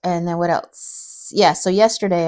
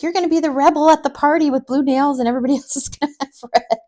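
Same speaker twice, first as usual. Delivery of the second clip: high-pitched, laughing mock tease; playful raised-voice imitation with a laugh; imitated teasing stranger; 8 semitones higher, 4 dB louder than usual. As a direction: tease like a loud salon customer, pitched up. Retells teasing by loud salon customer.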